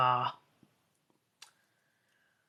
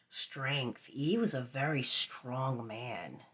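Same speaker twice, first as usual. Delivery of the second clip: normal talking voice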